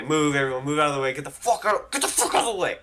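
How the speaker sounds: escalating reenacted shout to clear room; urgent retold shout. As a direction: order a crowd back, building in volume. Ordering crowd away from friend.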